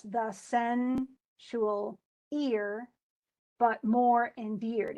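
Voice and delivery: very slow, each syllable weighted, the sensual ear; scanned slowly. Shown as a demonstration. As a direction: drag it out to show the stresses. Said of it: "but then it's unstressed, stress".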